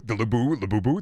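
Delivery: ordinary talk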